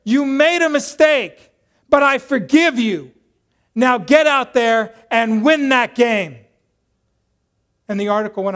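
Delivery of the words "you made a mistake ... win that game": loud, high, emphatic pep talk; rousing coach. Retold or imitated. Coach forgives his player and sends him out, rousing shout. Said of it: "and he says".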